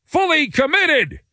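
high-pitched, slow, insistent proclamation; imitating an owner shouting, drawn out; mock shouting. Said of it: "starts shouting".

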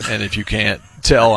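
usual speaking voice

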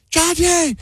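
very high falsetto imitation of a yelling wife; shrieky mock evacuation call, no real volume; hammed-up panicked wife. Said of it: "I'm upstairs and she yells".

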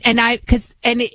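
ordinary talk, nothing marked

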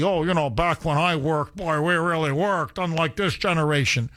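put-on grumbling old-timer voice; hammed-up nostalgic old-timer; mock middle-aged fogey. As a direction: imitate a fogey reminiscing about the good old days. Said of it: "some middle-aged fogey".

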